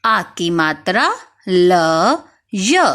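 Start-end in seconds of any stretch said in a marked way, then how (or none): none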